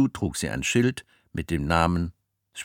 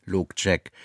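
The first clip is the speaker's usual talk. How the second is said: ordinary delivery, nothing marked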